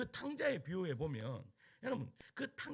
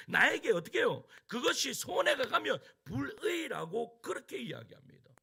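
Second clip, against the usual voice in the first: loud, raised-pitch sermon delivery; forceful preaching; 4 semitones higher, 10 dB louder than usual. Real, not acted. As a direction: press searching questions on the congregation at full force. (힘주어 외치는 설교)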